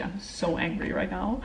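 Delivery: ordinary delivery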